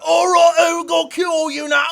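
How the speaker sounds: booming orc threat, shouted; big orc voice. Retold or imitated. Storyteller voicing a hulking orc's threat, shouting. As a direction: roar it like a giant brute orc. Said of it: "and they're like".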